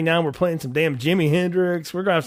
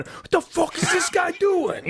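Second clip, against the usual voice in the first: high, slow mock shout; hammed-up high scream, slow; 13 semitones higher, slower than usual. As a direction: yell it like an exasperated director. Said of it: "screaming at a P A".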